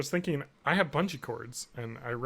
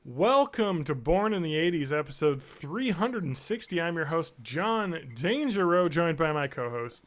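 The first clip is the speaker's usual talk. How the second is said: announcer; 3 semitones higher than usual. Acted. Podcast intro read.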